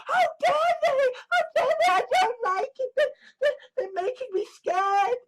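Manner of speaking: high, whimpering, fake-tearful pleading; mock crybaby whine. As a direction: squeal it like a scared child. Mocking a frightened whiny critic.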